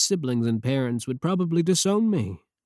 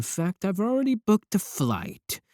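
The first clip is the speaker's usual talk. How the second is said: higher pitched eager reveal; unhinged glee; 9 semitones higher than usual. Acted. Streamer telling the fan she is coming, giddy and high. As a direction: let the obsession spill out brightly.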